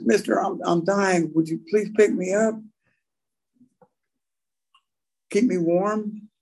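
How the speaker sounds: high, pitiful begging snake voice; pleading snake. Retold or imitated. Snake begging the walker to pick him up, high pitiful plea. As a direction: beg like a dying little snake. Snake begs the man in story.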